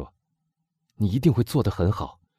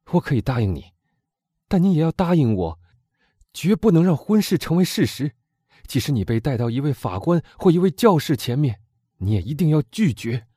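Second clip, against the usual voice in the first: urgent plea; 7 semitones higher, 4 dB louder than usual. A narrator's reading. Morrel pleading with Valentine.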